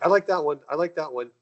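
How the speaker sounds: put-on pointing at planets like a kid in a candy store; eager picking. Retelling to host how he eagerly chose planets, eager.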